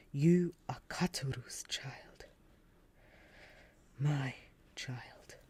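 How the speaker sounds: a father's breathless, hushed recognition; tender whisper. Acted. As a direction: whisper it as if afraid it isn't real. Father recognizing his lost child.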